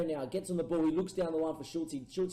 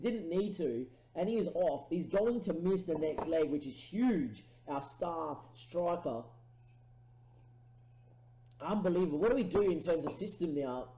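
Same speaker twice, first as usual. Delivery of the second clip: ordinary delivery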